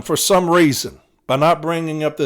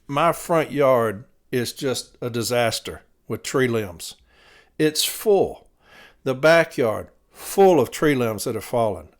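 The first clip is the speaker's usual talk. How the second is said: ordinary talk, nothing marked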